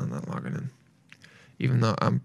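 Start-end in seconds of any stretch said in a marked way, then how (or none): 0.0-0.7 breathy whispered aside. Real, not acted. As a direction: drop to a whisper, half to yourself. Drops to a whisper.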